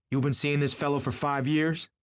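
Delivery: high, accusing question; sharp disbelief. A narrator's reading. Tom confronting Daisy about the affair, sharp disbelief. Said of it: "Tom turned to Daisy sharply".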